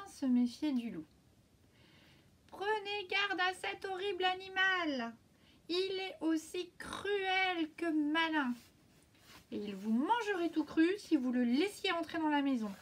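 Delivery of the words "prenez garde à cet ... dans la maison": lightly voiced mother goat, raised and stern; mother goat warning. A narrator's reading. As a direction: warn the kids like a worried mother goat. Mother goat warns her kids.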